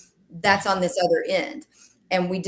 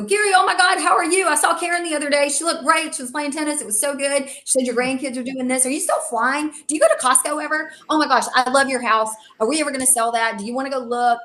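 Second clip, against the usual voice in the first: much higher, fast, louder rush of chatter; gushing excitement; 7 semitones higher, 4 dB louder than usual. Retold or imitated. Greeting client Gary on the phone, breathless high gushing. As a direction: gush at him without pausing for breath. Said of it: "that's how I would just wanna talk to Gary".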